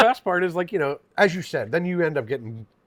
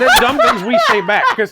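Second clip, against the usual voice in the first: a very high, louder line broken by laughter; laughing; 5 dB louder than usual. Real, not acted. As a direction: laugh your way through the punchline. Laughing at the joke.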